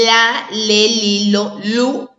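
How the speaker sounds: "la, le, li, lo, lu" sounded out one by one, slowly; "la, le, li, lo, lu" drilled as separate syllables. Shown as a demonstration. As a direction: chant "la, le, li, lo, lu" like teaching children. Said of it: "con el sonido L".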